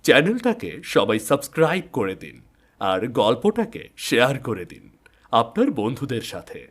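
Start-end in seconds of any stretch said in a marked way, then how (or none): none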